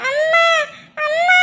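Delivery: high, upset call for mother; tearful calling. Acted. Upset child calls to mother during quarrel, tearful. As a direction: call out for mum, pitched high and close to tears. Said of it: "அழக்கூடாது, அழாத".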